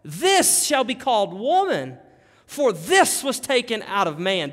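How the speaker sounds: amazed, passionate proclamation; impassioned exclamation. Acted. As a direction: exclaim it, punching the word THIS. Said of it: "that has exclamation points all over".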